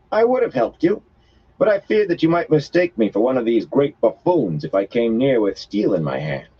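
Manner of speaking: high, lightly voiced foreign woman; woman explains herself to Matt after the fight. A narrator's reading. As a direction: answer with cool, odd formality.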